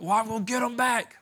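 mock tough guy. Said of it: "you're like".

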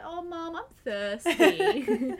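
high, drawn-out whine to mum; hammed-up needy plea, stretched out; babied daughter. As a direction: play up being a helpless child wanting mum. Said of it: "babying me".

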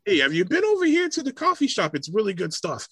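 very high, bubbly overfriendly greeter; high-pitched chirpy stranger; gushing friendly local. Retold or imitated. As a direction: squeal the welcome like an eager Dane. Mimics overfriendly Danes.

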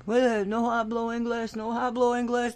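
a hint of Spanish accent, imitating; imitated Spanish-speaking worker. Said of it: "He's talking with an accent".

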